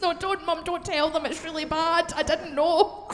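half-laughing embarrassed protest; embarrassed plea. Real, not acted. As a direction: beg mum to stop.

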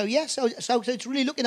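usual speaking voice

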